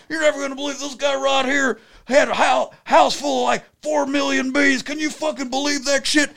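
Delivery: high, excited Southern ranting; Southern man impression. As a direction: tell the whole line of strangers in a Southern accent. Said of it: "So he's a Southern man".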